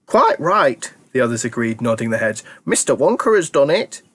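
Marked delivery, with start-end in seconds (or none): 0.0-0.9 put-on high, loud old-folks voice; an old grandparent insisting with glee; eager old grandparent. A narrator's reading. Grandparents correcting Charlie, high and eager. 2.7-4.0 put-on an old grandparent insisting with glee; eager old grandparent. A narrator's reading. Grandparents correcting Charlie, high and eager.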